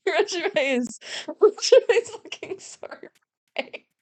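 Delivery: laughing through the words; giggling while talking. Real, not acted. Laughing at own joke.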